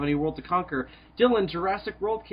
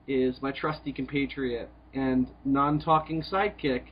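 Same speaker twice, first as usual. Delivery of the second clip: usual speaking voice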